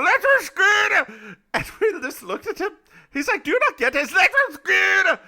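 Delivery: high, loud comic Scottish brogue; shouted Scottish accent. Pretend. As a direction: bellow the punchline as an incomprehensible Scot. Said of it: "with just this accent I have right now".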